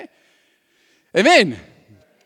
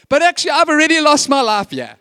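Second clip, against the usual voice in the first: preaching emphasis; 8 semitones higher, 7 dB louder than usual. Real, not acted. Preaching climax.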